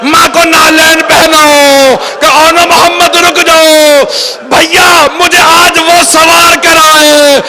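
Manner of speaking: keening high voice, rising to a wailed climax; lamenting preacher. Acted. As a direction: voice the farewell as an impassioned lament. Acts out tragic farewell in lament.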